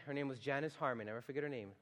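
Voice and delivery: ordinary talk, nothing marked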